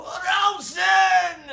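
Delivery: very high, very loud yell; shrieking a random word while swinging on the bar; random shout. Retold or imitated. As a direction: blurt it out like a Tourette's outburst. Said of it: "He would just shout random stuff".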